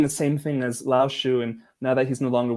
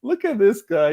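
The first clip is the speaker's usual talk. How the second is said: higher, louder and slow mock delight; delighted onlooker; 9 semitones higher, 7 dB louder, slower than usual. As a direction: savour it like a thrilled fan. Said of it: "They would just be like".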